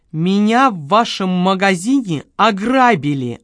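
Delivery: slow, emphatic accusation; deliberate, indignant enunciation; each word hammered out. A narrator's reading. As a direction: stamp out every word distinctly. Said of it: "отчеканила клиентка".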